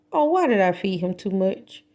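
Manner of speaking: plain reading voice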